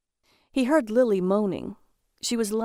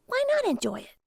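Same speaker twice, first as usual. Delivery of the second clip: much higher, playful character voice; faster than usual. Acted. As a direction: lift it way up, light and teasing. Lily's line, voiced high.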